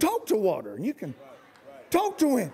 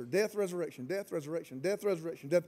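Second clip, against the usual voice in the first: much softer, lower, mock-rote repeating; droning repetition; 6 semitones lower, 10 dB quieter, faster than usual. Preacher mocking churches' Easter focus, soft and droning. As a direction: chant it like a rote church formula.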